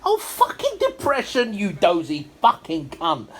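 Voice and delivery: sudden high yell at the screen; angry outburst. Real, not acted. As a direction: snap at the telly in disgust. Swearing at the TV.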